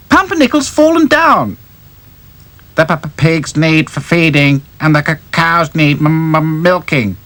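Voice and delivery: high, anxious stammering doll voice; stuttering doll. Acted. Doll reporting to friends, high nervous stammer. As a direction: stammer it out on every hard word.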